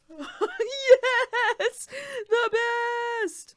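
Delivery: high, laughing almost to tears; laughing squeal. Real, not acted. Delighted by the Watson pizza pin, squealing through laughter. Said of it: "I was like".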